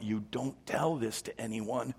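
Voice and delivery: slow, hushed warning, dropping toward a whisper; strong warning. Acted. Jesus warning the healed leper, hushed and slow. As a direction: warn them low and under your breath. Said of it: "with a strong warning".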